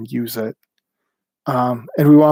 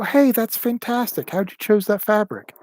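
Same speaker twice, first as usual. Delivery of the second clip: much higher, bright encouraging compliment; enthusiastic praise; 8 semitones higher than usual. Retold or imitated. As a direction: greet the newcomer with delight. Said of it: "We go".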